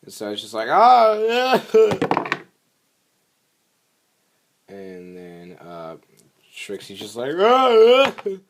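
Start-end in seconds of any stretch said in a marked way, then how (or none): 0.7-2.4 very high, loud cartoon cry; hammed-up squealed alarm; mock wail of dismay. Retold character's cry of dismay. 7.3-8.4 high, loud drawn-out cry; hammed-up cartoon distress; mock wail of dismay. Retold Trixie's cry of dismay.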